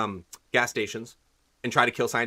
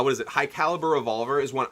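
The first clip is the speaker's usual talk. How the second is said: normal talking voice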